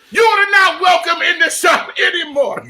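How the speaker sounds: loud, high-pitched comic shout; imitated irate shopkeeper. Pizza man banning the caller from the shop, imitated shouting. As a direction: yell it in a put-on voice, far above your own. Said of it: "he goes like this".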